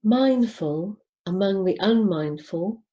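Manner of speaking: normal talking voice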